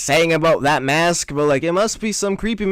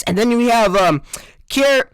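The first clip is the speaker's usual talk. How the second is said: usual speaking voice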